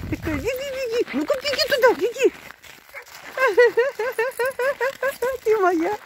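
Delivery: high-pitched cheerful calling to a goat; sing-song excited coaxing. Real, not acted.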